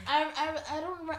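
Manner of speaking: tipsy and giggling. Real, not acted. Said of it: "I'm a little tipsy".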